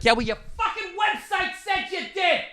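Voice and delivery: very high strained squeal; acted shrieking outrage. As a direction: squeal the retort far above your voice. Imagined furious retort to clerk.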